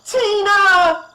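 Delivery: very high, loud sobbing shout; mother's sobbing scream for her daughter; crying scream. Acted. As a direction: wail her name. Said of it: "scream with crying".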